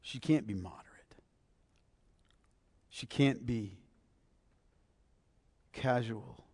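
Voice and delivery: soft, near-whispered emphasis on can't; hushed preaching. Real, not acted. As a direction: drop the voice to a hush. Preacher drops to a hush.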